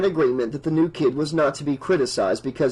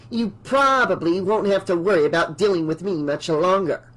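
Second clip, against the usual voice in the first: higher, haughty landlady voice; haughty old woman; 4 semitones higher than usual. Acted. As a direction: look down on her, coolly. Voices Mrs Deagle, cold.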